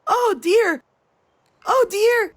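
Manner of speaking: put-on high, loud toddler wail of oh dear; screaming toddler. Mother mimics her sick toddler crying out oh dear. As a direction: cry it out like a frightened small child.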